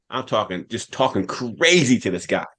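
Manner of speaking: usual speaking voice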